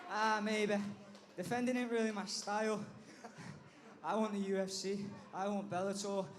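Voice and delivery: ordinary talk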